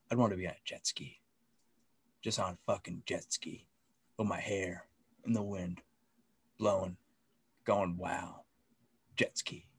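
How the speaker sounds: dreamy Mobius. Retold or imitated. Mobius wistfully daydreaming about his jet ski, voiced soft and dreamy. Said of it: "Mobius is like".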